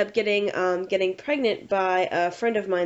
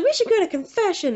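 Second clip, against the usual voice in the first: high, keen mock enthusiasm; over-keen retold proposal; eager suggestion; 11 semitones higher, 4 dB louder than usual. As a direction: imitate a friend pitching it eagerly, much higher. Friend eagerly proposes confession, retold.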